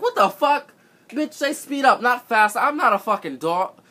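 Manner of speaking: high, loud indignant yell; furious outburst. Retold or imitated. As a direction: blow up at her, outraged. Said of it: "I'm like".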